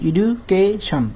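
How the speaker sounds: এডুকেশন as edu... kay... shon; short gaps between the parts of এডুকেশন; three-beat split. Shown as a demonstration. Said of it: "তিনটা অংশে ভাগ করা যায়".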